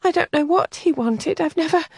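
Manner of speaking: put-on a sheltered girl close to tears; upset young lady. Helen confides in Kathleen, high and distressed.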